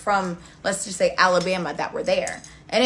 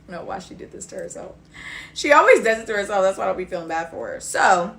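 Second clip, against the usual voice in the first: ordinary talk, nothing marked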